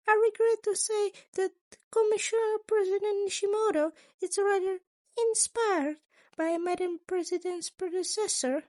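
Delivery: a sly, chipper school principal asking; Nezu's voice. A narrator's reading.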